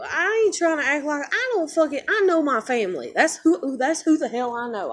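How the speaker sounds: high, loud, with a bitter laugh; angry rant. Real, not acted. Vlogger ranting at commenters about who she knows, loud and bitter. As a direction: shout it out, half laughing in disbelief.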